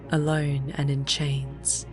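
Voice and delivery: ordinary delivery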